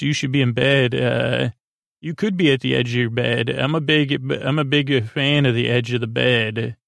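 ordinary delivery

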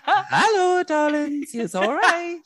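very high, laughing mock glamour voice; femme fatale. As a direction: purr it like a campy Harlem femme fatale. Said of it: "me with my mobster outfit on".

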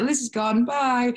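playful high narration of her zoning out; mock announcement. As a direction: sing out a cheeky goodbye. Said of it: "I was like".

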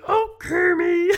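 very high, loud, slow swooning Miss Piggy. Retold or imitated. Mocking her own accent as a Miss Piggy cry. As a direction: swoon like a diva pig. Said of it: "like Miss Piggy being like".

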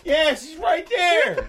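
slow, high, laughing coo; cooing at a dog. Real, not acted.

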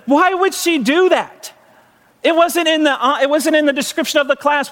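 aghast husband reacting to the nurse's plan. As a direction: protest in rising horror. Horrified at placenta plan.

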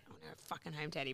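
hushed whiny plea under the breath; a hushed homesick whimper to Daddy; secret whine. Retold or imitated. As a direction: mutter it like a homesick kid.

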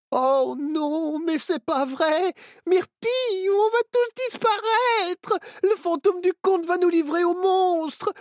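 put-on a child losing it with fear; panicked wail. A narrator's reading.

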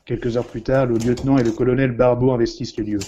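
ordinary talk

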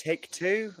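hopeful asking. Retold or imitated. Co-star imitating the actor asking for another take, hopeful. Retelling a hopeful request for retake.

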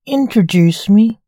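eager loud demand; excited loud request. A narrator's reading. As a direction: call it out boldly. Eager prince in a story.

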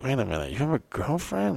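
soft mock confusion, rising high at the end; very confused. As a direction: imitate a baffled girl, quietly puzzled. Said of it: "they'd be very confused".

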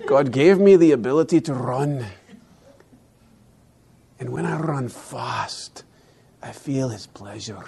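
a deliberately bad Scottish accent, fading to a hush; hammed-up bad Scottish accent, getting soft and reverent. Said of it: "I'm gonna use a really bad Scottish accent".

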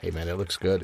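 ordinary talk

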